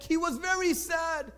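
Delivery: usual speaking voice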